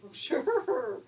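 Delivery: loud, high laugh into sure; laughing pig. A narrator's reading. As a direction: laugh it out, happily agreeing.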